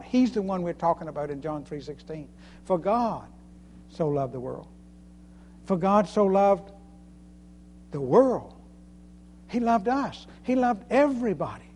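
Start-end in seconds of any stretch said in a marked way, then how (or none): 2.6-4.7 God stressed, then a pause before the rest. Shown as a demonstration. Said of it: "It was God". 5.7-8.5 pause, then "world" punched out loud; stress on WORLD. Shown as a demonstration. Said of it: "meditating on Scripture by emphasizing different words".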